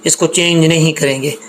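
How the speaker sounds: ordinary delivery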